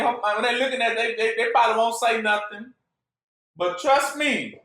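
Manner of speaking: ordinary talk, nothing marked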